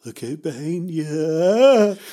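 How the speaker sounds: drawn-out loud cry; shouted warning. A narrator's reading. Pavel scaring Jenny in the shadows, drawn-out shout. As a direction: stretch the last word into a big yell.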